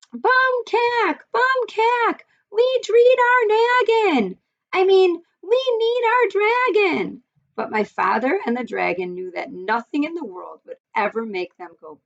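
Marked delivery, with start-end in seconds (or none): 0.1-7.2 very high, squeaky little mouse screaming; tiny excited mouse voice. Acted. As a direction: squeal it like a tiny frantic mouse calling after them. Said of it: "here's how he says it".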